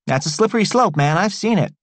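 lightly voiced excited young guy; Tea Cake's voice. A narrator's reading.

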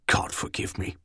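very soft, half-whispered muttering; muttered. A narrator's reading. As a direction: mutter it under your breath, almost voiceless. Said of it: "he muttered".

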